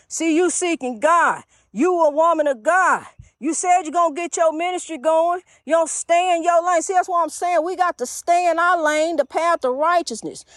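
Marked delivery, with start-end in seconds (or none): none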